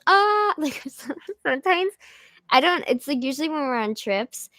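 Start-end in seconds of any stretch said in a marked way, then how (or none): 0.1-0.5 sudden high shouted ah, copying dad; a loud startled cry as dad does in sleep; imitated sleep yell. Imitating dad yelling ah in his sleep. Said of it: "He goes".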